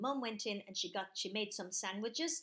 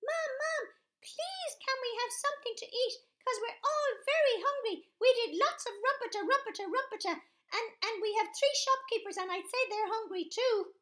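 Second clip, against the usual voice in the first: put-on high, eager little child voice; baby asking mum for food; 14 semitones higher, 7 dB louder than usual. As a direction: chirp it like a hungry toddler. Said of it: "he said to his mum".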